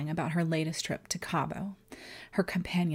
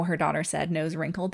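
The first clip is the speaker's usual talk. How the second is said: plain reading voice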